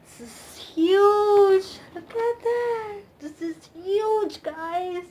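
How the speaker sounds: high, loud, slow amazed exclaiming; awed exclaiming. Real, not acted. Fan unfolding a giant poster, awed exclaiming. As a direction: stretch out the wonder.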